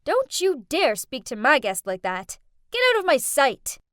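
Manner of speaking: loud, cold dismissal; angry order. Acted. Jasmine ordering the maid out of her sight, angry. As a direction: throw the maid out with contempt. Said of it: "Jasmine got annoyed and said".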